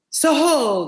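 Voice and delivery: high, loud sing-out; loud call. Acted. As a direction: call out to stop the rider. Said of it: "the guard sang out as loud as he could".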